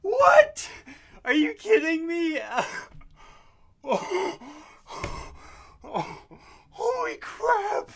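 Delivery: shrieking, near-crying disbelief; ecstatic screaming. Real, not acted. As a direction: scream with tears of joy. Real elation after first-try success.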